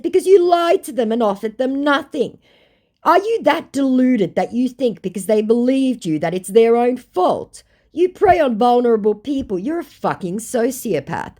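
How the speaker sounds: high, loud re-enacted tirade; outraged tirade. Woman answering a victim-blaming scammer, outraged.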